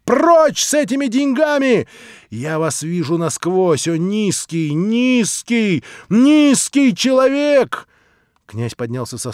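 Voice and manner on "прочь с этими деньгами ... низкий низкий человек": high, loud scream, slowing into contempt; furious scream. A narrator's reading. Natasha screaming scorn at the prince over his money. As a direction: spit each низкий louder. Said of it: "закричала Наташа".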